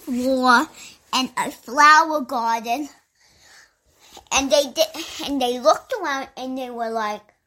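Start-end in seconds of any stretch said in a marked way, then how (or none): none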